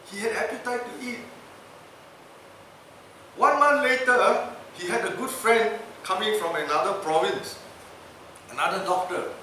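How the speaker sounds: usual speaking voice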